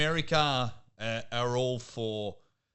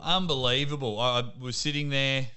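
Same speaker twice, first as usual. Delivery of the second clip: usual speaking voice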